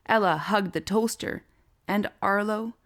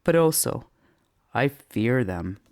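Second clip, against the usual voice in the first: lowered deadpan confession; mock-fearful mutter; 5 semitones lower, 4 dB louder than usual. A narrator's reading. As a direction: let the fear creep in low. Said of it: "he muttered".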